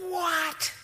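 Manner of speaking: very high, loud squeal of disbelief; astonished what. Acted. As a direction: squeal it in mock amazement. Repeats the astonished reaction.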